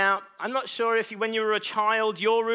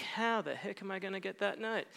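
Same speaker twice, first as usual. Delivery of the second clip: 1 quiet inner thought; 2 semitones lower, 11 dB quieter than usual. Retold or imitated. Kid thinking, daunted by messy room.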